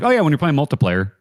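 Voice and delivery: light Upper Midwest accent impression; imitating Minnesotan accent. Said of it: "but also like".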